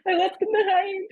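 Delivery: put-on high, loud tearful wail of a distressed girl; hiccuping distress. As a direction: blurt it out through hiccups, upset. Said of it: "she starts hiccuping".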